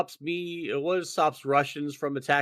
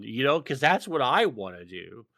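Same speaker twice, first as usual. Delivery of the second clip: usual speaking voice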